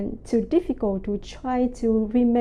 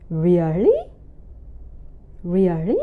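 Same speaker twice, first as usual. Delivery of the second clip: surprised; 2 semitones lower than usual. Shown as a demonstration. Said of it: "really surprised with something".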